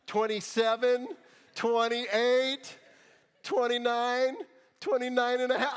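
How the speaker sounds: loud, high, drawn-out counting; mock stalling count. Mocking the mom's counting to her kid, loud drawn-out count. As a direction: count like a parent stalling the threat.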